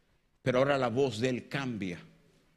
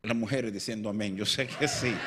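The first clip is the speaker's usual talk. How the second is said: ordinary talk, nothing marked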